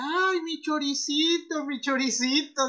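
high, slow mournful wail; hammed-up lament over lost food; mock whimper. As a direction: wail it like a heartbroken kid. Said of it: "lo que más gracia me daba era que decía".

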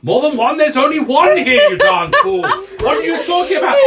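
high, loud incredulous wizard; exasperated wizard master. Acted. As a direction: berate your servant in rising exasperation.

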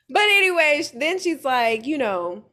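normal talking voice

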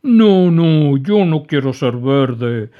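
loud, high, slow protesting sob; inconsolable crying. A narrator's reading. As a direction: refuse through tears. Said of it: "seguía llorando desconsolado".

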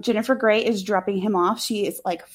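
ordinary delivery

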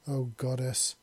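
ordinary delivery

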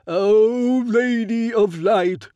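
very slow, high, reverent invocation; solemn prayer. A narrator's reading. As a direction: lift it to the heavens, slowly. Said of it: "I realize it is a prayer".

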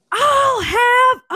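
slow, high mock bellow; imitating a furious customer's full-volume shout; imitated shouting. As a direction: yell it drawn out at the top of your lungs. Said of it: "at the top of his lungs".

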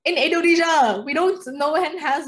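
loud, high-pitched protest; emphatic retort. Real, not acted. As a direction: fire it back high and loud. Heated teasing retort to friend.

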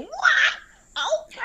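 high shouted hype-man ad-lib; imitated ad-lib shout. Showing friends what the singer said in the back, imitated ad-lib shout. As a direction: mimic a rapper hollering from the back. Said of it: "he was in the back saying".

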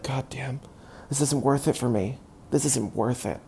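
very soft defeated grumble; muttered aside. Retold or imitated. Life coach giving up on her, muttering softly. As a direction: mutter it under your breath, beaten. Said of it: "he'd be like".

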